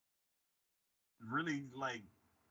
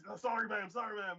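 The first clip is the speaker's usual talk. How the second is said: raised pitch, hurried apology; mock apologetic guard; 8 semitones higher than usual. Guard apologizing to the boss, mock meek.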